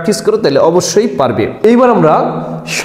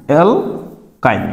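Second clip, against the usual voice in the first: অ্যালকাইন stretched slow and a little softer; slowly drawn out; 4 semitones lower, 3 dB quieter, much slower than usual. Shown as a demonstration. Teacher modelling the name for students, slow and drawn out. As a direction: spell out অ্যালকাইন slowly by ear. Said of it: "এটা উচ্চারণ হবে কি?".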